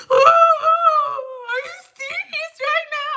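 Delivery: high, crying mock wail of the client. Client crying at the stylist, high mock wail. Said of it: "standing there crying".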